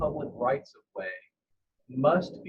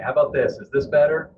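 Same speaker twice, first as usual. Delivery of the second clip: louder with boosted mic; clearer, louder call voice; 9 dB louder than usual. Real, not acted. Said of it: "yeah, that's a lot better".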